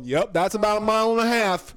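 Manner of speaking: goofy confident guess; lightly voiced old sailor; mock sailor estimate. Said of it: "how does one person go".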